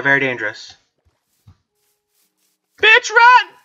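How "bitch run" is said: very high, loud shriek to flee; panicked yell. Real, not acted. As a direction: scream it in sudden panic. Fleeing monster again.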